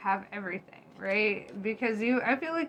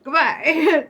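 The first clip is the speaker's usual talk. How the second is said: mock outrage; 6 semitones higher, 8 dB louder than usual. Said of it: "and you're like".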